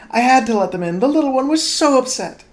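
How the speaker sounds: high, loud flustered gasp; a panicked maid blurting it out; gasping housemaid. Acted. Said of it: "the housemaid gasped".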